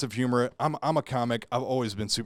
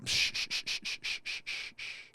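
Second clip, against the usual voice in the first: unvoiced shh fading away, softer each time; shushing letters; 10 dB quieter, slower than usual. Shown as a demonstration. Showing the nurse the silent letters, fading shush. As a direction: hiss the silent letters out, dying down. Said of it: "All the letters are silent".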